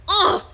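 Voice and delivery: loud, high "ugh"; exasperated groan. Real, not acted. Breaking off while explaining a workplace rumor, exasperated groan.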